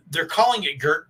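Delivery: normal talking voice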